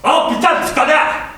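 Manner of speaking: loud, high French swearing; angry French curse. Shown as a demonstration. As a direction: curse out the car in French like it just cut you off. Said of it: "say it in a foreign language".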